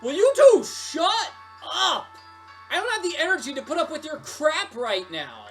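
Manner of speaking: high, loud exasperated outburst; fed-up shouting; angry yell. Acted. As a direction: snap and yell at the bickering customers. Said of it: "did you just yell at us?".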